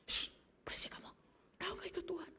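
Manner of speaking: sharp hushing whisper; scolding whisper. Acted. Disciples hushing the noisy woman, scolding whisper. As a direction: hush her down, annoyed.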